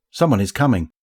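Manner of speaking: ordinary delivery, nothing marked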